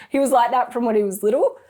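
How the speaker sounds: normal talking voice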